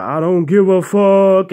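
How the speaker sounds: slow, stretched, furious refusal; defiant shout. Retold or imitated. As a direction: bellow it right in his face. Shouting at the ghost dog.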